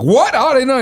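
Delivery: high, loud astonished "What?"; mock surprise. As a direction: play the stunned conductor. Conductor surprised about meet and greet.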